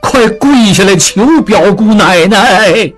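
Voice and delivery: slow drawn-out call to kneel; loud urging call. A narrator's reading. Jingqing urges the Qiao family to kneel, drawn-out loud. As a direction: call out to the crowd, spelling it out. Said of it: "大声说".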